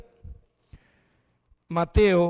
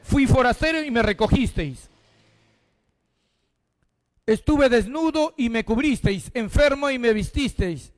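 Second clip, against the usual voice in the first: higher, fervent reading voice; preaching scripture; 5 semitones higher, 3 dB louder than usual. Acted. Pastor reading Matthew to the congregation, fervent and raised. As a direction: proclaim each line of the verse. Reads the Gospel aloud.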